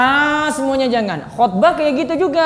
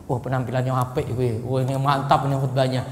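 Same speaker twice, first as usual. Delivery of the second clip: lower, softer approving murmur; an admiring aside from the crowd; lightly voiced villager praising the preacher; impressed listener; 11 semitones lower, 6 dB quieter than usual. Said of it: "Orang lihat dari penampilan dulu".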